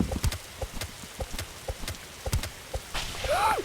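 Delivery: sharp high gasp of fright; startled gasp. Real, not acted. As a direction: gasp in real shock as the trap hits. Nearly dies in trap.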